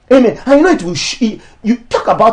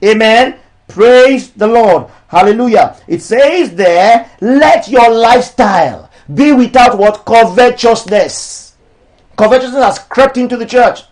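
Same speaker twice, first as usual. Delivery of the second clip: raised, declamatory sermon voice; preaching; 3 semitones higher, 4 dB louder than usual. Acted. Pastor preaching against charging for ministry, declamatory. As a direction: preach it to the church, each line landing. Preacher reading and proclaiming scripture.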